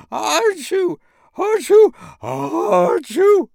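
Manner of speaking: very high, loud sneezes; huge sneezes. Acted. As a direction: sneeze with all your might. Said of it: "sneezing as hard as he could".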